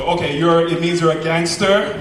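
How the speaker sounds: ordinary talk, nothing marked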